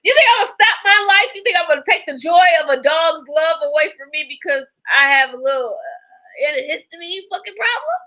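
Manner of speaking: loud, high-pitched outburst; shouting rant. Real, not acted. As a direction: yell it in defiance. Ranting about allergies, yelling.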